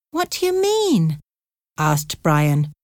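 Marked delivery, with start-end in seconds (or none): 0.1-1.2 high-pitched puzzled question; confused. A narrator's reading. Brian asking Jimmy about the goat, confused. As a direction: ask it high, quite baffled. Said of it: "He was very confused".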